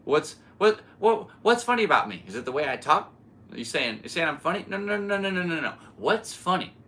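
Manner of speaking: a lightly voiced touchy mobster, demanding and escalating; Joe Pesci impression. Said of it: "Joe Pesci stops everything and says".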